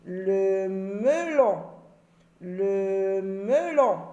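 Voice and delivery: "melon" said very slowly, with "me" drawn out; very slow model word. Shown as a demonstration. Teacher to pupils, modelling "melon" very slowly. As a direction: stretch every sound of "melon". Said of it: "J'entends E".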